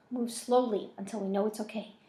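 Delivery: plain reading voice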